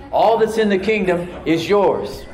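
loud, high, drawn-out proclamation; emphatic declaration. Real, not acted. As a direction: proclaim it slowly and forcefully to rouse the congregation. Insistent repeat after muted congregation response.

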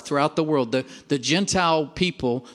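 normal talking voice

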